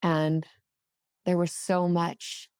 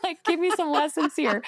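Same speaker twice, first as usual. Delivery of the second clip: much higher, louder, bewildered questions; baffled confusion; 13 semitones higher, 4 dB louder than usual. Retold or imitated. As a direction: sound totally lost and asking for help. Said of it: "even more confused about what's happening".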